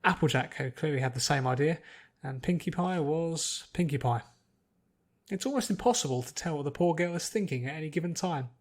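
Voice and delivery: plain reading voice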